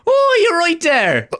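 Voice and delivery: very high, loud, drawn-out mock Irish; over-the-top Irish put-on, stretched out; hilarious Irish accent. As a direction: ham up an Irish accent, slowly squealed. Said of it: "putting on a hilarious accent".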